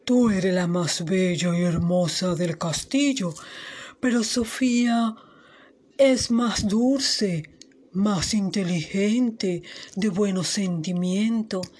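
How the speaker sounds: espejo mágico con voz más grave y pausada; voz del espejo. Acted. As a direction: responde lento, alargando las palabras. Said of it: "el espejo le respondía". (el espejo contesta a la reina, voz grave y pausada)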